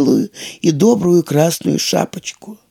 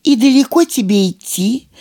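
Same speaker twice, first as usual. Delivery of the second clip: higher, sugary sweet voice; wolf sweetly coaxing the girl; 7 semitones higher than usual. A narrator's reading. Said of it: "тем же сахарным голоском".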